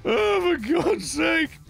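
squealing laugh into exasperation; helpless high laugh. Real, not acted. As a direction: laugh high and breaking, then groan slowly. Laughs at own mistake.

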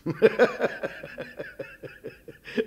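laugh. Acted. Doctor laughs at the menu.

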